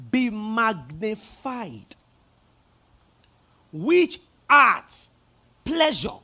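drawn-out, solemn reading of the verse; slow scripture reading. Acted. Pastor reading scripture slowly to the church. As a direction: read it slowly to the congregation.

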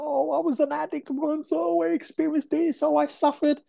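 mock your old complaining self; mock whining. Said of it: "complaining and bitching about".

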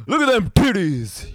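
high hooting bro voice; dudebro voice. Acted. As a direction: lewd jock hollering. Mocking dudebro voice.

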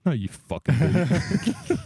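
incredulous retort. Retold or imitated. Disbelieving retort to poker opponent.

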